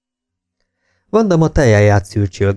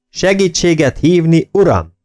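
high, raised corrective bark; narrated shout; 8 semitones higher than usual. A narrator's reading. Jakes makes Kemel add the title, shouted correction. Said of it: "kiáltotta Jakes".

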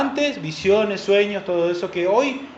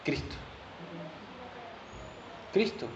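usual speaking voice

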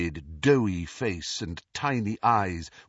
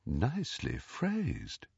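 soft knocker voice; 2 semitones higher, 8 dB quieter, slower than usual. A narrator's reading. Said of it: "replied the eagle door knocker".

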